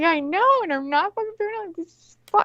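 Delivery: giddy and high. Retold or imitated. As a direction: squeal it like you're blissfully high. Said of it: "I was just so happy".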